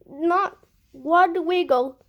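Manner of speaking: plain reading voice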